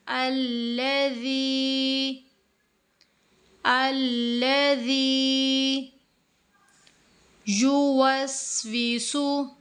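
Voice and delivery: drawn-out, measured tilawat; slow recitation. Shown as a demonstration. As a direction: recite slowly, holding the long vowels. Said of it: "खींच कर नरमी से". (धीमी तिलावत)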